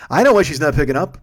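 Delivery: pointed retort. Retold or imitated. Driver snapping at his lovesick passenger, loud and pointed. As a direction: lean into it, you know exactly why.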